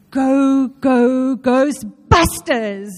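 loud, drawn-out chant; imitating someone's sudden loud outburst; retold outburst. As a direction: belt it out slowly, word by word. Retelling husband's sleep-deprived outburst.

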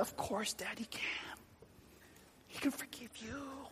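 breathy whisper, gentle; whispered reassurance. Retold or imitated. Son whispering to his father that God forgives him. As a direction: hush it to soothe him.

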